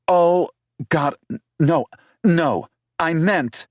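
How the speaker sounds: panic, backpedal fast; horrified, stammering embarrassment; flustered denial. Acted.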